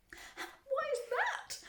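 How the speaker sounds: high, slow demanding question; shrill shouting. A narrator's reading. Mother challenging the factory owner, shrill shouting. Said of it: "shouted Missus Gloop".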